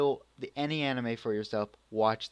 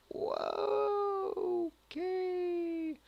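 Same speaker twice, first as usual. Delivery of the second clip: very high, drawn-out falsetto; mock unease; much slower than usual. Vlogger reacting to the girl's quick temper, falsetto mock unease. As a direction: stretch it out in an exaggerated squeak.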